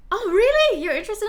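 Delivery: loud, high mock interest; naively eager. Imitating people who miss the sarcasm, naively eager. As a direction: imitate someone who took the sarcasm for real. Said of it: "And they're like".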